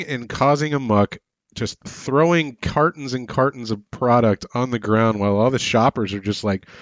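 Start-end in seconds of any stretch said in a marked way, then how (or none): none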